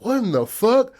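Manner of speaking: high startled outburst; shocked. Retold or imitated. Old man finds the dead cat, startled outburst. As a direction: blurt it out in shock.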